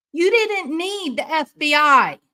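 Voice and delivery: real anger at the police; angry outburst. Real, not acted. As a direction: hit them with it at full heat. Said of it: "I'm mad".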